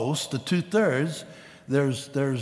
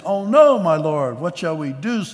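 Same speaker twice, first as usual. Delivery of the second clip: much higher and much louder, alarmed cry of panic; frightened servant; 4 semitones higher, 7 dB louder than usual. Acted. Servant panicking as the army surrounds the city, frightened cry. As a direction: cry out in sudden panic, then ask for help. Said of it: "the servant asked".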